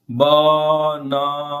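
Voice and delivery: BANANA drawn out slowly, all three syllables equally long and level; every syllable equal. Shown as a demonstration. Teacher showing learners how not to say it, BANANA with equal drawn-out syllables. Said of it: "with equal level, equal sounds, equal stress".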